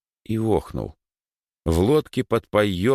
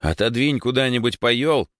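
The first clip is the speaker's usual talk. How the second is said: brisk, raised-pitch order; commanding; 7 semitones higher than usual. Acted. Rower tells boy to move floorboards, commanding. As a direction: give a firm instruction. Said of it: "велел Егор".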